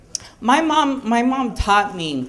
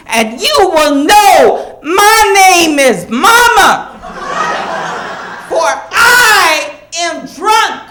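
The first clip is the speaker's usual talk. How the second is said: slow preacherly build to a loud, much higher shout; Pulp Fiction hitman speech; 8 semitones higher, 5 dB louder than usual. Retold or imitated. Mom performs the hitman scene for her kids, building to a shout. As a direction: mom performing Jules's speech, climaxing in a yell. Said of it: "she did the hitman scene from Pulp Fiction".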